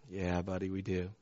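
very soft, low answer; subdued fatherly reply. Retold or imitated. Dad answering his kid about leaving friends, hushed and low.

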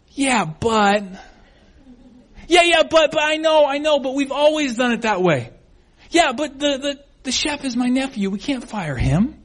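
high, stammering excuse-making; defensive protest. Acted. Restaurant owner resisting the chef's advice, defensive.

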